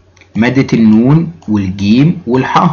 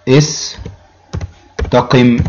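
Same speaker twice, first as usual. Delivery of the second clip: استقم stretched sound by sound; teacher slowly dictating استقم; very slow drawn out; much slower than usual. Shown as a demonstration. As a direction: draw out استقم very slowly so students hear each letter. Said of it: "نقول مثلًا إيه؟".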